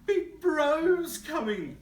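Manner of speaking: high, loud, slow frog warning; panicked frog. Acted. Frog crying out that Big Bro is coming. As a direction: stretch out the alarm.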